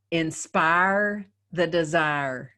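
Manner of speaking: slow, drawn-out Southern accent. Shown as a demonstration. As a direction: drawl it like a Southern preacher. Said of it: "when I ramp up my Southern accent".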